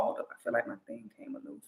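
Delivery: real whisper, very soft, to herself; a hushed quick aside while fixing something. Real, not acted. Aside, fixing her mic.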